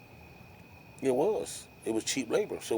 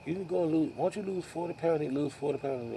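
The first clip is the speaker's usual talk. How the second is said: higher, mock nagging wife voice; hammed-up wife telling him to lose weight; imitating wife nagging; 6 semitones higher, 5 dB quieter than usual. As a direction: parrot his wife's scolding in a raised pitch. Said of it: "she's on my behind about".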